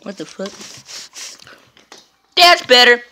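ordinary talk, nothing marked

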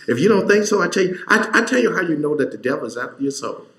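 rising sermon fire; preaching at full force. Real, not acted. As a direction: preach it hard to the congregation. Preaching climax.

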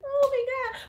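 very high, thin squeal; hammed-up helpless dismay; mock distressed teacher. Mimicking an overwhelmed colleague facing her students, mock distress. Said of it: "she be like".

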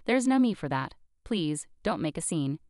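usual speaking voice

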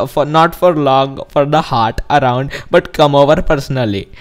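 put-on Indian accent reading; mock Indian-accented speaker. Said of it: "'Cause I'm doing an Indian accent".

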